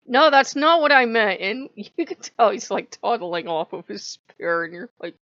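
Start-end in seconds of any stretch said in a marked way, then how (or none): 0.1-1.4 loud high alarmed protest; loud panicked correction; flustered protest. Real, not acted. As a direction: hurry to take it back. Hastily correcting the war god.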